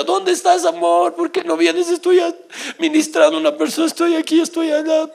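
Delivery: wife calling out. Retold or imitated. Husband quoting wife calling him.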